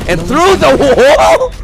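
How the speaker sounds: high excited yell; excited shout. Real, not acted. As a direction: shout it as the kill lands. Caster reacts to wallbang kill.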